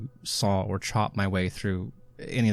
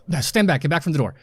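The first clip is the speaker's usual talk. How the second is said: raised, urgent warning; warning call; 6 semitones higher, 6 dB louder, faster than usual. Acted. Hero to prisoners before chopping the lock, warning call. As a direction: call out to clear the way.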